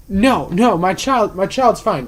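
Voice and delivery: mock impatient outburst; retold yelling. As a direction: imitate an irate mother brushing off concern. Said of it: "she's yelling at me".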